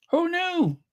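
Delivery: who knew, sung up high; amazed. Acted. Telling viewers about Meghan, high and amazed. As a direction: marvel at it in a high voice. Marvelling at the story.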